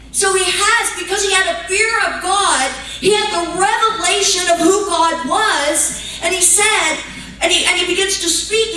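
high, loud, climbing preacher's cry; preaching at full force. Acted. Preacher telling the congregation about the repentant thief, preaching at full force. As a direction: pour it out like a preacher at the peak of the sermon.

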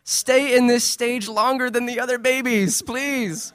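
put-on high, drawn-out gushing to a baby; slow, high-pitched baby-adoring voice; doting parent. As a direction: plead adoringly with a little one. Father dotes on his baby.

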